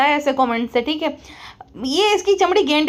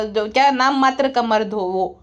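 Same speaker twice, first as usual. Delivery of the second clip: usual speaking voice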